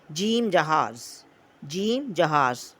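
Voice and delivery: slow, stressing जीम in जीम जहाज़; teacher modelling जीम जहाज़ for children to repeat; slow model reading. Shown as a demonstration. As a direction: say जीम slowly and clearly before जहाज़. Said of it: "मेरे साथ पढ़ें".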